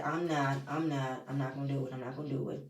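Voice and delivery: low-voiced imitation, dropping away; retold quiet refusal. Quoting King backing out of it.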